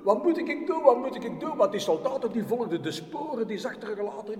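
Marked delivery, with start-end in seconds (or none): 0.0-1.6 loud, high desperate cry upward; calling for help. Acted. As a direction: call up to heaven in panic. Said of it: "die had geroepen".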